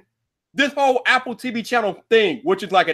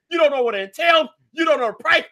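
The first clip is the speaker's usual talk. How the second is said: ordinary talk, nothing marked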